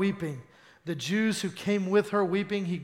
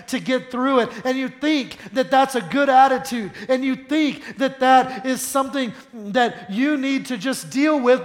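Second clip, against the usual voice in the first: high, loud, fast sermon delivery; driving, urgent preacher; full-force preaching; 5 semitones higher, 8 dB louder than usual. Real, not acted. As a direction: preach it out at full volume, pitched up.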